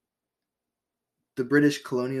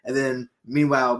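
normal talking voice

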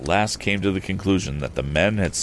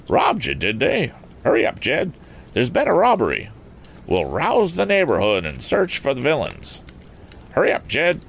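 higher rustic urgency; excitable country farmer; 8 semitones higher than usual. Acted. Farmer hearing of the robbery and urging Jed, high excited voice. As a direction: rouse your son as an excited farmer. Farmer rallies his son.

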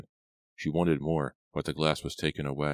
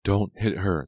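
higher, louder protest; sudden cry; 5 semitones higher, 6 dB louder than usual. A narrator's reading. Girl stops man hitting captive, with a sudden cry. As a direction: cry out to stop him. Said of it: "the girl suddenly cried".

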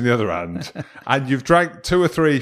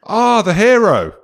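drawn-out, higher, louder cry of awe; mock hero worship; 8 semitones higher, 6 dB louder, slower than usual. Co-host hamming up praise for the hard man, slow and loud. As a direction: hail him like a stadium idol. Co-host hails Jim Hamilton.

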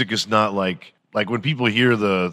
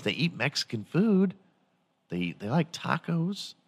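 mock Jill Biden musing; 5 semitones higher, 8 dB quieter than usual. Jill Biden musing before a speech.

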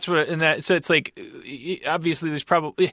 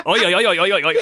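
laughing mock New Zealand oi; hammed-up Kiwi oi; bad New Zealand accent; 6 semitones higher, 5 dB louder, much faster than usual. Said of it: "bad New Zealand accent".

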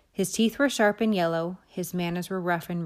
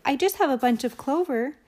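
timid rabbit; 7 semitones higher than usual. Acted. Rabbit speaks.

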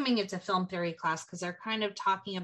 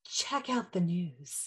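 slow, drawn-out mock drama; over-the-top actress; 3 semitones lower, 4 dB quieter, slower than usual. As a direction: imitate an overdramatic line reading, drawing out each word. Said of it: "her physical acting was very over the top".

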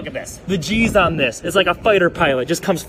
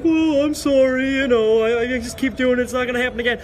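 mock fake apology; 4 semitones higher than usual. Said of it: "And Ross is like".

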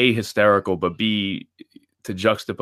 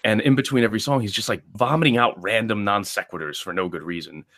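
ordinary talk

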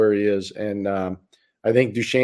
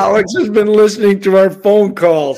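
higher, louder, laughing tease; laughing joke; 8 semitones higher, 6 dB louder than usual. Real, not acted. Co-host jokes the listener hears their calls, laughing. Joking about tapped calls.

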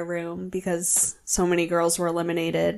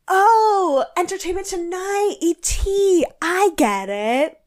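high, slow ditzy aha; mock realization; 10 semitones higher, slower than usual. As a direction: ham up a dumb discovery. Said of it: "a shot of the girls going".

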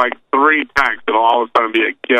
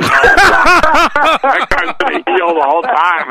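laughing through the words, slowly; 6 semitones higher, much slower than usual. Real, not acted. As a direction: let the laugh carry the line. Laughing while describing wrestling fans.

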